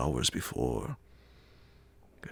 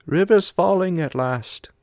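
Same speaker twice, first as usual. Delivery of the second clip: much higher, louder foreign companion voice; the Swede; 13 dB louder than usual. A narrator's reading. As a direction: give the Swede a high, loud, strained voice.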